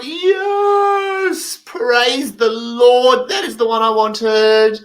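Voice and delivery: high, loud overjoyed praise; ecstatic cheer. Retold or imitated. Cheering a friend who finally got it right, high and loud. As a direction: shout it like a miracle happened.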